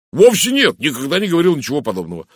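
high, loud outburst, then quick; indignant denial. A narrator's reading. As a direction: deny it hotly and hurriedly. Indignant denial to Poirot's question.

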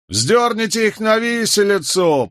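high cry egging on a crowd; mob shout. A narrator's reading. Someone in the crowd demanding a hanging, mob shout. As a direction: call for blood, pitched right up. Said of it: "подхватил кто-то".

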